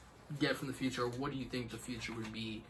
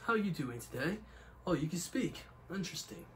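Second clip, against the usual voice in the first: attempted British slipping into Australian; higher-pitched put-on Australian greeting; Australian accent; 4 semitones higher, 3 dB louder than usual. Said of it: "You went to Australian accent".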